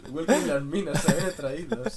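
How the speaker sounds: mock host welcome, laughing through it. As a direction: laugh while greeting them in.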